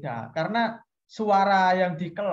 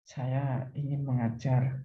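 low monotone; 7 semitones lower, 5 dB quieter than usual. Shown as a demonstration. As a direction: drop the pitch and drag each word. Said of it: "Jangan misalnya". (suara rendah, pelan dan datar)

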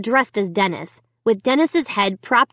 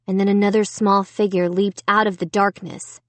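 plain reading voice

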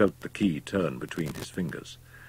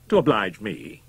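higher, louder retort; scornful, raised-voice question; indignant cry; 4 semitones higher, 6 dB louder than usual. A narrator's reading. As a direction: throw it back in disbelief. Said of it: "cried Wolfe".

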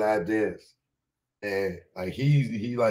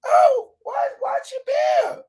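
put-on shrieking high-pitched girl, much higher and louder; mock girly shriek of surprise; high shocked girl's cry; 8 dB louder, slower than usual. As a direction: squeal it like the startled young dancer. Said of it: "And she like".

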